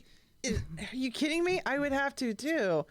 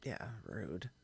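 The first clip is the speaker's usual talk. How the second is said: whisper; 13 semitones lower, 13 dB quieter than usual. Real, not acted. Agreeing quietly.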